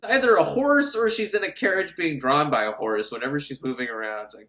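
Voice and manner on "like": ordinary delivery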